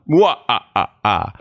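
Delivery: hammy vampire chuckle; Dracula laugh. Retold or imitated. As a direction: laugh like a mustache-twirling Dracula. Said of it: "what if Dracula was a comedy writer".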